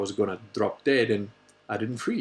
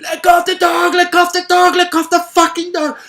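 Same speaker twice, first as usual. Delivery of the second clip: high, loud panicked yell; father's frantic high shout across the vineyard; retold desperate scream; 11 dB louder than usual. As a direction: scream it at a kid racing toward a cliff. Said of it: "running and screaming".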